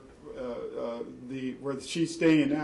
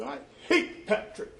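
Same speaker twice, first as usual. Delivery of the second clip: put-on child's words; 10 semitones higher than usual. Child's retold hateful outburst.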